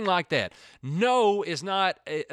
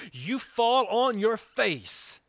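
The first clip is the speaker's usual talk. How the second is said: drawn out, each word weighted; slow it right down and press the point; slow emphatic preaching; 5 semitones higher, slower than usual. Real, not acted. Preacher's key command.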